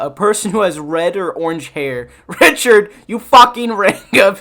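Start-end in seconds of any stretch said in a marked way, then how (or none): 2.4-4.4 higher, louder hammed-up telling-off; mock angry insult. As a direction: snap at Richard in mock outrage. Acted insult from dictionary example.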